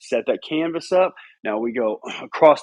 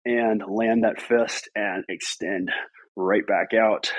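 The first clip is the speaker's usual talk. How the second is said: slow, controlled; 6 semitones lower, slower than usual. Shown as a demonstration. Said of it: "That's about the speed we want".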